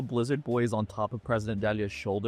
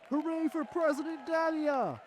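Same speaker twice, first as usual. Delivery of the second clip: cheering crowd; 13 semitones higher, 4 dB quieter than usual. Acted.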